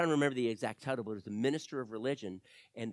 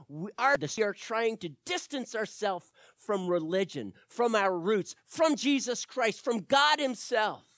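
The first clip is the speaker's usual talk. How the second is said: rising higher and louder, slowing at the end; building preacher's cry; 8 semitones higher, 9 dB louder than usual. Acted.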